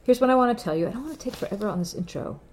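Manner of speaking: usual speaking voice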